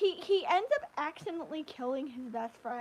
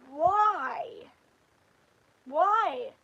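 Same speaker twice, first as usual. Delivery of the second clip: exasperated; 8 dB louder than usual. Real, not acted. Exasperated complaint about dub accent.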